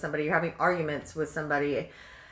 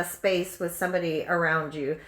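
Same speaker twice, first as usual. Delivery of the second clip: ordinary talk, nothing marked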